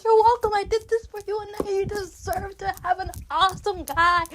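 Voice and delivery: high-pitched, loud mock exchange; gushing reply. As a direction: answer like an overexcited, doting fan. Acts out her own gushing answer.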